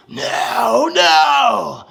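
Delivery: high, loud shrieked no no; victim's screams. Retold or imitated. Imitating the victim's screams, shrieked. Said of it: "those fucking screams".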